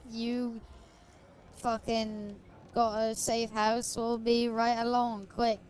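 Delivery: exaggerated British chap voice; British accent. Streamer playing game, doing mock British accent. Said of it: "I could do a not bad British accent".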